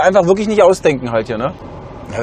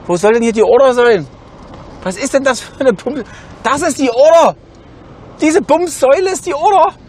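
berserk rage; 7 semitones higher than usual. Real, not acted. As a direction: erupt in disbelief at the stupid kiosk. Wutausbruch über die Bestellsäule. (sich in rasende Wut steigernd)

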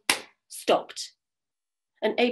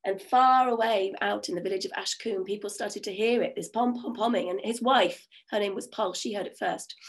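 ordinary delivery, nothing marked

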